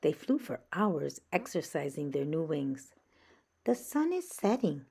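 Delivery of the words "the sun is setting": warning. Acted. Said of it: "warned Flitter".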